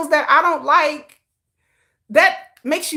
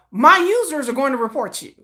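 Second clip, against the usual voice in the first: normal talking voice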